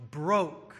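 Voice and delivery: "broke" leaned on as the key word; stress on broke. Shown as a demonstration. Preacher to congregation, emphasis on "broke". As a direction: land on "broke" with weight, end of the phrase. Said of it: "Emphasis on that word broke".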